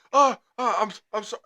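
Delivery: high, stammering imitation of apology; flustered apologizer. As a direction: imitate a driver fumbling an apology. Imitates the apologetic other driver.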